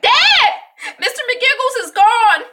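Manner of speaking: put-on high, loud panicked kid call; alarmed child yell. Annie tells Dad the lizard is gone, alarmed yell. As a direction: imitate a child hollering for dad.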